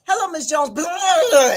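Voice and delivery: loud garbled mock chatter; greet, then blur into gibberish; mimicked run-together babble; imitating unintelligible fast talkers. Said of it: "all I heard was".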